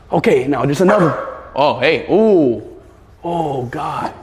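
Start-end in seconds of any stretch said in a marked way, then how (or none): none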